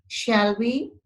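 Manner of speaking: "shall we" lifting up on "we"; rising question tag. Shown as a demonstration. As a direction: suggest it, letting "shall we" rise at the end. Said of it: "The voice goes up".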